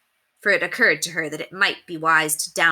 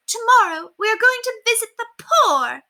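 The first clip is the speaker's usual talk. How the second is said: the word poor spat out with disgust; disgusted snob spitting the word poor; 5 dB louder than usual. Acted. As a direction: spit poor like a rotten seed. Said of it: "spit out the word poor".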